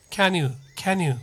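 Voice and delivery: "Can you" as one word, "kanyu", falling; short joined "Can you", n gliding into you; linked pronunciation. Shown as a demonstration. Teacher models the correct "Can you", linked. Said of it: "となります".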